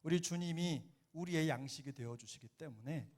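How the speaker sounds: usual speaking voice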